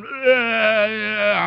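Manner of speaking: put-on whiny wordless toddler groan; imitated child whine.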